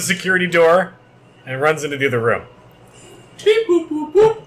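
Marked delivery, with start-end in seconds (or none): 3.4-4.4 beep boop noises. Acted. GM voices console buttons.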